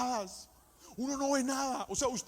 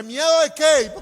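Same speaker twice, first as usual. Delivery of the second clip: bravado; 7 semitones higher, 15 dB louder, slower than usual. Retold or imitated. Bravado answer to wife.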